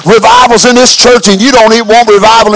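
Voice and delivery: loud, stern rebuke; preacher scolding. Retold or imitated. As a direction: scold him like an angry pastor. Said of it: "He said".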